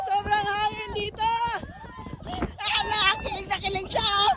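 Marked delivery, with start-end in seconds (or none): none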